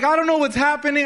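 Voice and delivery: high, quick panicked babble; panicking. Retold or imitated. Brother panicking at the car door, quick high panicked voice. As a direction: gabble it high and fast like a scared kid. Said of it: "He's panicking".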